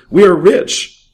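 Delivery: high, loud gloating cry; gleeful. A narrator's reading. Thief gloating to his partner over the banknotes, excited. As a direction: crow over the loot. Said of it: "cried Jules".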